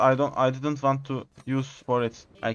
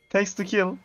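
usual speaking voice

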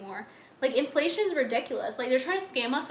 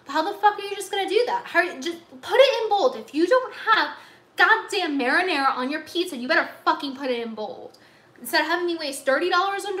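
loud, higher, furious rant about the pizza; angry rant; 3 semitones higher, 4 dB louder than usual. Real, not acted. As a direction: bellow it out in real outrage. Ranting about missing marinara.

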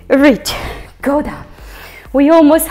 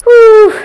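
exertion whoop; 8 semitones higher, 11 dB louder than usual. Real, not acted. Effortful cry during lunges.

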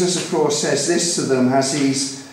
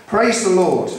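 raised, ringing call to worship; psalm call to praise; 5 semitones higher, 4 dB louder than usual. Real, not acted. Preacher opens the psalm for his congregation, raised proclamation. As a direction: proclaim it higher, as a summons. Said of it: "I'm gonna read it".

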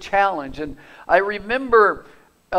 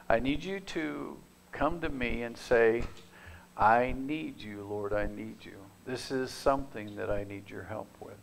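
soft sincere plea; 5 semitones lower, 8 dB quieter than usual. Acted. Pastor voicing God's call, quiet and tender. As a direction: lay it down gently before God.

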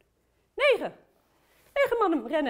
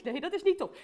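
usual speaking voice

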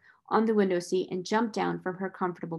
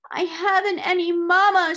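put-on high, loud childish proclamation; lifted-up wail; 10 semitones higher, 7 dB louder than usual. A narrator's reading. Motherless child announces her woe, lifting up her voice. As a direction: proclaim it as a wailing little girl. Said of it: "she preferred to lift up her voice".

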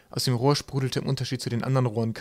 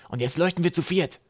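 high-pitched little character voice; eager crewmate Trapp; 7 semitones higher than usual. A narrator's reading.

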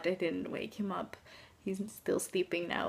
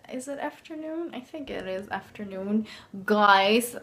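usual speaking voice

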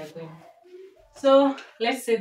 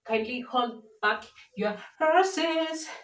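slow, pointed warning; 4 semitones higher, much slower than usual. Real, not acted. Telling off the viewers.

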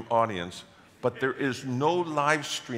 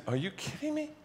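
shocked disbelief; 5 dB quieter than usual. Retold or imitated. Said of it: "And I said".

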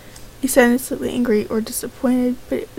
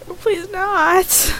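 frightened plea; 6 semitones higher, much slower than usual. Acted. Character fears being killed.

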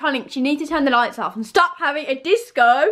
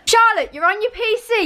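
higher, indignant call-out; accusing; 7 semitones higher than usual. Real, not acted. Calling out her friend at the PC, accusing. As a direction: catch her out, pitched up. Calls out friend caught cheating.

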